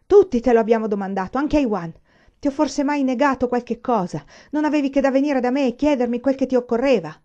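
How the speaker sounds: loud, high, hurt reproach; angry shouting. A narrator's reading. Father yelling that his son could just have asked, angry. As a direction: pour out the grievance.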